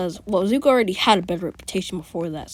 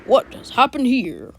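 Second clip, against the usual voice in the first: surprised All Might; 3 dB louder, slower than usual. Retold or imitated. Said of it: "All May will be like".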